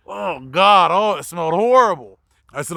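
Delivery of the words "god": usual speaking voice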